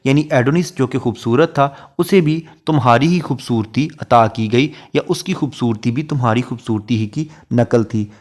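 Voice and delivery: ordinary delivery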